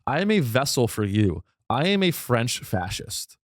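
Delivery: usual speaking voice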